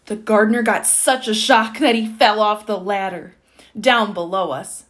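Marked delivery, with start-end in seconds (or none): none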